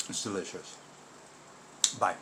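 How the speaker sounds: talking while chewing; muffled through a mouthful; mouth full. Real, not acted. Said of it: "speaking with my mouth full".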